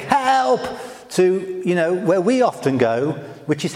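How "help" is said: a short higher yelp of mock panic; panicked cry. Moses in panic, brief mock cry. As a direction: throw out a little cry for help. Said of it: "this panic".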